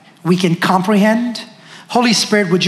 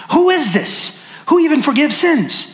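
indignant guests; 8 semitones higher than usual. Acted. Guests at table question Jesus.

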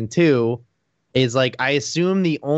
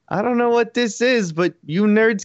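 Bill Burr impression; 10 semitones higher than usual. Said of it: "And he just goes".

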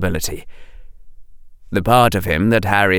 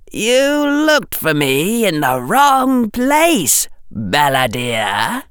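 cold Dark Lord voice; 12 semitones higher, slower than usual. A narrator's reading.

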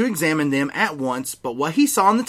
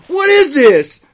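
livid shout; 12 semitones higher, 9 dB louder, slower than usual. Acted. Snape beyond livid.

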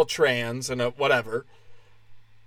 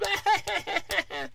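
high mock giggle; 6 dB quieter than usual. Mock heckler laughter.

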